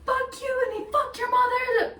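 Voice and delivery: high, sharp retold yell at the stranger; mom yelling back. Mom yelling at mocking guy.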